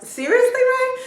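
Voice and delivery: high-pitched reluctant question; awkward dad. Retold or imitated. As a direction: imitate a dad squirming out of it. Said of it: "he looks all awkward".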